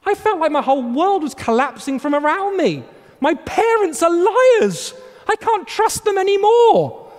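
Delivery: put-on a heartbroken kid finding out; childish despair. Child's world collapsing.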